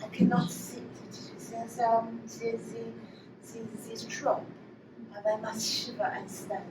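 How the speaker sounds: soft, feeble French waif; quiet, halting French accent. Acted. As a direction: put on a hushed French flower seller's accent. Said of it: "with an actual French accent".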